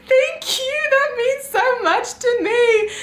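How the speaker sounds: high, slow, sugary thanks; gushing thank-you. Retold or imitated. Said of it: "And I was like".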